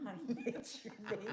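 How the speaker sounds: soft laugh through words. Real, not acted. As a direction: giggle it out. Laughing after scare.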